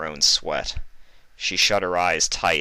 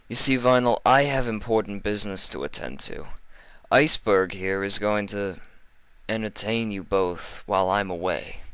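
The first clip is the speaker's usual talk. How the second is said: oily, higher mock-kind villain; sly doctor voice; 3 semitones higher than usual. Livestrong taunting the injured Fluttershy, oily and sweet. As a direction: coo falsely sweet like a sadistic doctor.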